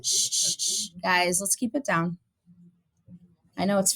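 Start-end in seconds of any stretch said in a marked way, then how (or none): 0.0-0.9 shushing the class. Real, not acted. Teacher hushing noisy class.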